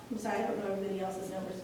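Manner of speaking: ordinary delivery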